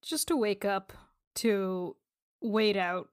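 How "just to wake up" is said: ordinary talk, nothing marked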